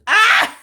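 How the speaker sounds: short high loud yelp of surprise; a startled cry out, high and loud; sudden yelp. Real, not acted. Streamer yelps at something on the stream, sudden high loud cry.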